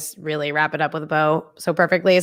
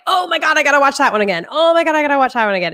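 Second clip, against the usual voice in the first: put-on high, eager gushing over old favourites; quick, high-pitched excited rush of words; 9 semitones higher, faster than usual. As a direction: gush like you just remembered a great movie.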